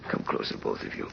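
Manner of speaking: breath failing, words sink to a whisper; fading whisper. Acted. Dying father calls his children close in a fading whisper. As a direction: let the voice slip away on the last words.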